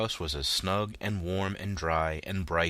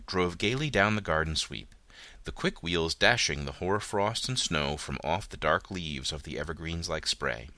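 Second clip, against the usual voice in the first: plain reading voice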